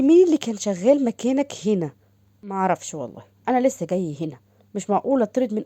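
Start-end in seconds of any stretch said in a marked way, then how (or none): none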